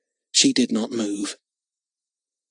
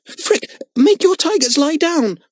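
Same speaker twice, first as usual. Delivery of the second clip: high, louder desperate demand; urgent plea; 5 dB louder than usual. A narrator's reading. Girl begging the boy to calm the tigers. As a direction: order him in panic.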